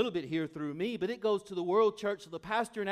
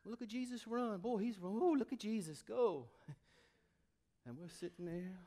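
ordinary delivery

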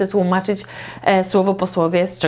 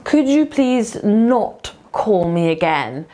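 not stretched out and set apart; slow model phrase, lean hard on not; stress on not; much slower than usual. Shown as a demonstration. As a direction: punch not, then finish call me again. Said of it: "not właśnie tak zaakcentować".

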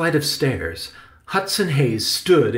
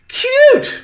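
very high, loud shriek of disbelief; piercing incredulous outburst; indignant charge; 11 dB louder than usual. A narrator's reading. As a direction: fling the word back, outraged. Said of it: "Victoria charged".

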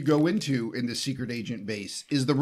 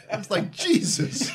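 laughing disbelief; 5 dB louder than usual. Real, not acted. Reacting laughing to desk gag.

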